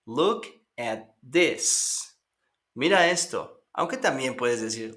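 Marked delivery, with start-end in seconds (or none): 0.0-2.2 very slow "Look at this"; slow teacher model; slow repeat for learners. Shown as a demonstration. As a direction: give students a slow echo to repeat.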